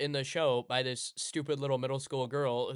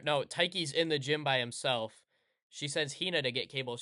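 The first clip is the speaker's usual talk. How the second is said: ordinary talk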